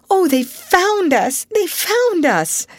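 high, drawn-out cry of relief, near sobbing; overcome, loud and high-pitched; half-sobbed cry. A narrator's reading. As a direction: cry it out slowly, half in sobs. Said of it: "half sobbed Ruth".

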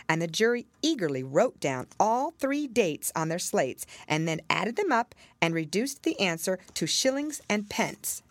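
plain reading voice

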